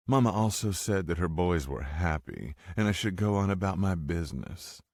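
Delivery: mock southern accent. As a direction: sneer through a Southern accent. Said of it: "She mocked Mama's southern accent".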